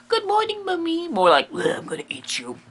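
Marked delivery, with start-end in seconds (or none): 0.1-1.1 high-pitched mock child greeting mum; sweet child to mummy. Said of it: "Not your mummy, like". 1.5-2.6 low-key monster groan, soft; undead mummy. Acted. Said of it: "That kind of mummy".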